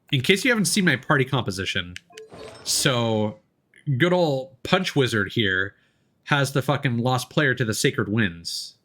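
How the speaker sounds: ordinary delivery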